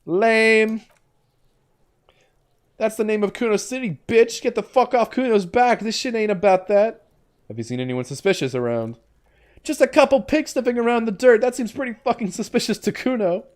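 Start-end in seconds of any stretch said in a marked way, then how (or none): 0.0-7.1 put-on high, brash kid voice; bratty kid voice, pitched up and cocky; Kuno the feral boy. Streamer voices Kuno, a boy character. 9.6-13.4 put-on high, brash kid voice; bratty kid voice, pitched up and cocky; Kuno the feral boy. Kuno voice again.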